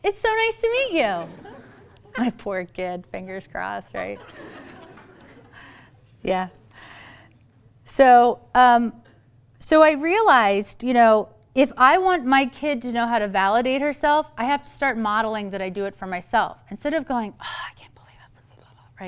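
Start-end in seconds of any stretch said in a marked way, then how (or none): 0.0-1.3 high, overexcited mom chatter; match her squeal with sugary enthusiasm; gushing greeting. Retold or imitated. Mom models greeting another mom for daughter, hammed-up gushing. 17.4-19.1 whispered grumbling under the breath; muttered self-criticism. Retold or imitated. Mom models poor self-talk, whispered mock grumble.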